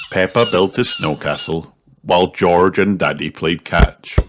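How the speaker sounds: ordinary delivery, nothing marked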